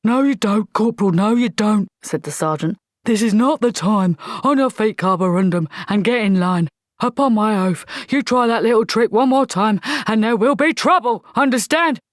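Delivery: loud barking drill sergeant; gruff sergeant. Acted. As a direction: bark it like an old army sergeant.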